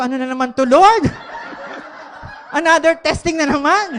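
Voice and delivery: loud, high exasperated cry; mock exasperation. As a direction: throw up your hands at God. Exasperated at God during holdup.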